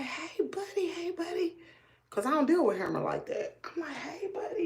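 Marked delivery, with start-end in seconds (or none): 0.0-1.6 put-on high, soft baby-talk to a pet; real sweet. Owner rubbing scared dog in lap, real sweet. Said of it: "I'm being real sweet to him". 3.9-4.6 soft, high cooing to a dog; real sweet. Retold or imitated. Owner comforting shaking dog, real sweet and soft. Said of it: "I'm being real sweet to him".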